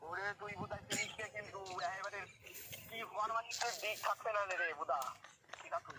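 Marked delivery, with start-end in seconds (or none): none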